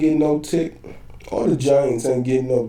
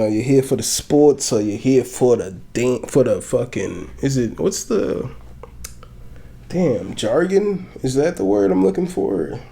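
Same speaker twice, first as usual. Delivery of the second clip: usual speaking voice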